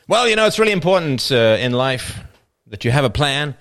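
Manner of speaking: high, loud speech bursting into a goal call; sportscaster as motivational speaker. Acted. Sportscaster giving life advice, erupting into goal shout. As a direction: build to a long screamed final word. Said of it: "one of these guys be motivational speakers".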